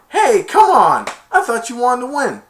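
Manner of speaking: high, loud indignant shout; indignant protest. Retold or imitated. Spike's dad protesting to Kevin, high indignant shout. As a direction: argue back hard.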